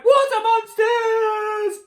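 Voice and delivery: very high, loud, drawn-out scream; terrified cry. A narrator's reading. Sister sees reflection in water, long panicked scream. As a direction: shriek it in panic. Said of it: "and one cried".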